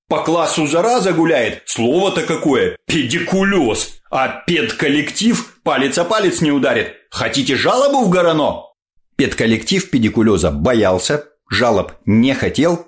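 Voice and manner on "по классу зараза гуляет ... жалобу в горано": put-on high, outraged old lady scolding; indignant grandmother. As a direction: huff it out like a scandalized granny. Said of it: "заходились бабушки праведным гневом".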